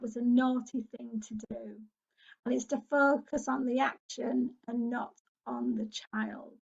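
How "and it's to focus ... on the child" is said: ordinary delivery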